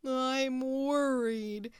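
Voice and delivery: slow, drawn-out mopey moan; moaning Walrus. Acted. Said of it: "moaned Walrus".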